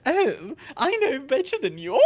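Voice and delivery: smug mock. Mocking people who belittle her, smug and high. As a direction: mimic a know-it-all. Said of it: "people are like".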